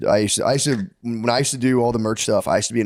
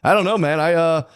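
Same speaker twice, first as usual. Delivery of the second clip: higher-pitched mock ditherer; dithering customer; 6 semitones higher, 3 dB louder than usual. Next guy dithers at the chicken stand, mimicked in a higher voice. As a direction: imitate a shopper who can't decide.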